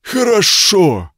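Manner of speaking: high, slow beastly growl. Acted. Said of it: "прорычал он неразборчиво".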